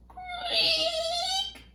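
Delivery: high squeaky creak sound; creaky door creak. A narrator's reading. Reader voices a dungeon door creaking. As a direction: make the creak of an old door, high and thin. Sound word in monster story.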